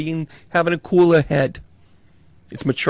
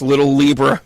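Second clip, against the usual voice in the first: ordinary delivery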